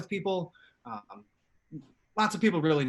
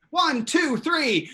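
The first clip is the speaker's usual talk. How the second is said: loud, high countdown to a group yell; yelled count-in; 10 semitones higher, 6 dB louder, much slower than usual. Real, not acted. Host cueing viewers at home to yell, shouted count-in. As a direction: count everyone in at a raised pitch. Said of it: "yell with me".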